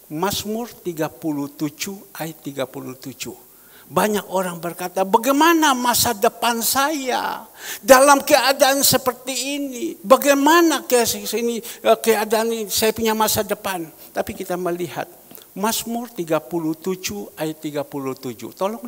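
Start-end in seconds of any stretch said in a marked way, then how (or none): 5.1-13.9 worried asking. Retold or imitated. Worried people asking aloud.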